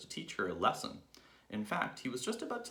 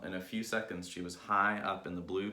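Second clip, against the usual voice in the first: plain reading voice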